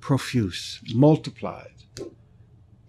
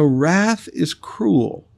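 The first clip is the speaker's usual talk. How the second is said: slow emphatic repeat; 3 semitones higher, much slower than usual. Acted. Preacher repeats the proverb slowly.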